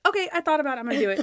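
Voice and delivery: high, instant mock agreement; acted impatient enthusiasm; eager decision. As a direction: blurt it out without thinking.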